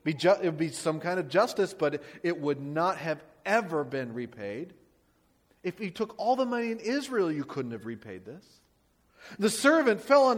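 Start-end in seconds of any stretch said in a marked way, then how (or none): none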